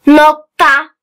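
slow, word by word with long pauses; dictating. Shown as a demonstration. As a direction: read it out slowly for pupils to write down. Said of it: "Ben metni yavaş yavaş okuyacağım".

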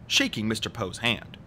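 usual speaking voice

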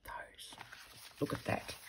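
soft unvoiced whisper. Real, not acted. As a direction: whisper it to yourself. Quiet aside while sorting labels.

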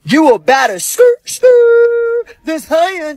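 high, drawn-out mocking threat; mocking angry threat. Dad threatens son, mocking his words.

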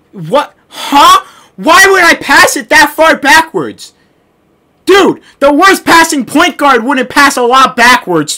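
loud, high incredulous protest; indignant disbelief. Real, not acted. Gamer after a backwards pass, outraged disbelief. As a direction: demand an explanation for a stupid glitch.

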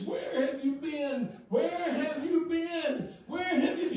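high, drawn-out retold wail; imitated tearful cry. Woman greets the long-awaited preacher, imitated high crying plea. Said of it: "She's crying like a baby".

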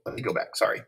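ordinary delivery, nothing marked

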